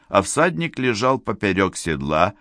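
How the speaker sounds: ordinary delivery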